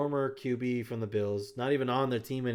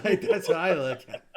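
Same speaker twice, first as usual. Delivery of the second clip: loud, higher, through laughter; laughing; 5 semitones higher, 7 dB louder than usual. Real, not acted. Co-host defending his joke about the college, laughing.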